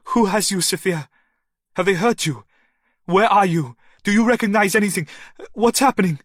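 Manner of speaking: high, loud, rushing panic; frantic man shouting down the phone; frantic questions. A narrator's reading. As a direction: fire the questions desperately.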